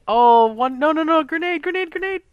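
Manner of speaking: sudden high shouted warning; grenade warning yell. Real, not acted. Coach panics at grenade in replay.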